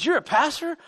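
retold surprised question; surprised teammate. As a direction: imitate a teammate's disbelief, pitch rising. Teammate's startled question in retold chat.

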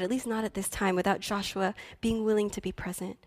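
ordinary talk

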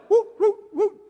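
put-on high whooping child noise. Child's squirming noises imitated.

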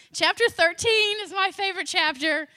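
a burst of excitement over a favorite chapter; excited. Real, not acted. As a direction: announce your favorite with delight.

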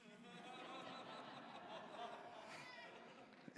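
high, soft laugh; a soft high chuckle; real laugh. Real, not acted. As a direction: laugh at the memory. Laughing at own garage sale joke.

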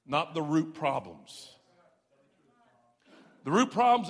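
loud, driving pulpit preaching; climbing sermon declaration; full-force preaching. Acted. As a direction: preach it hard to the congregation.

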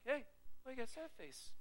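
casual Jesus. Retold or imitated. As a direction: ask it like a friend on the road. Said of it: "he's like".

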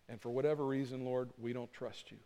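hushed, earnest appeal to God; closing prayer. Acted. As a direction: pray quietly on the congregation's behalf.

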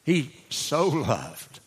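slow "he so loved", the word "so" leaned on; "so" drawn out slowly, then "loved" falling; stress on so. Shown as a demonstration. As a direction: draw out "so" and let "loved" fall. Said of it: "He didn't just love".

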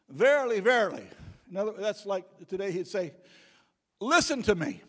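loud, high proclaiming voice; preaching at full force. Acted. Preacher booming Jesus' words to the congregation in full force. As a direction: thunder it out like a revival preacher.

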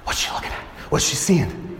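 whispered, nervous and curious; anxious whisper. Retold or imitated. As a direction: whisper it, dying to see the bride. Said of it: "and I go".

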